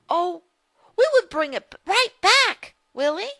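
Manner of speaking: put-on girl Rose excited. Little Rose shows her brother a nest, high and excited.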